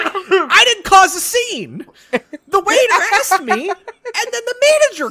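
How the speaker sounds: high, slow, indignant protest with a laugh. Retold or imitated. Son protesting to his parents, high and indignant.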